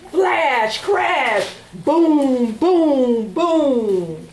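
dramatic thunder refrain; thunder booms. Acted. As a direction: shout the storm noises.

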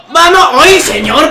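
high loud cheer; excited shout. Real, not acted. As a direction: yell at the chance. Another near goal.

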